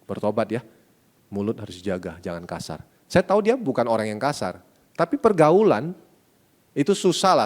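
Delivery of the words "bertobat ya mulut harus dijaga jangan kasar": soft, hugging-close reassurance; tender. Retold or imitated. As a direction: murmur it gently while holding him. Hugging son, gentle words.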